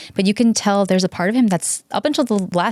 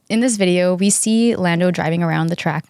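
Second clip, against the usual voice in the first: ordinary delivery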